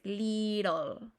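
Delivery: American "little", no crisp T; American tapped T. Shown as a demonstration. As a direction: draw out "little" as "liRol". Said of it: "los americanos no".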